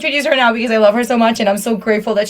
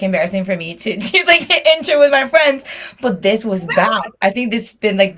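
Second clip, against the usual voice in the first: ordinary talk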